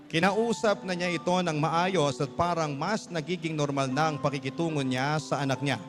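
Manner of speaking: ordinary delivery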